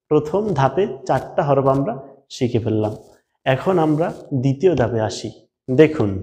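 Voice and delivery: ordinary talk, nothing marked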